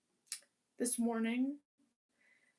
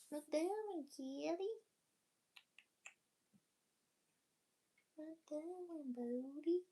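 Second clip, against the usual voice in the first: pet talk; 5 semitones higher, 3 dB quieter than usual. Real, not acted. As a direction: coo up high at the kitty. Cooing at the cat.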